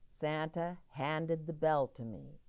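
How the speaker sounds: ordinary delivery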